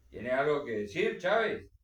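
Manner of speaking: loud challenge, rising sharply on the name; voz de mando. A narrator's reading. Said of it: "con esa voz suya acostumbrada a mandar". (voz fuerte, acostumbrada a mandar)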